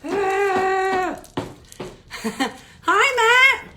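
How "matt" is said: ordinary delivery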